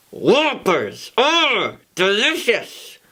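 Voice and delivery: lightly voiced girl, slow mock growl; a hint of a growling girl. Girl growling at the boy over candy, mock growl. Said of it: "the girl growled".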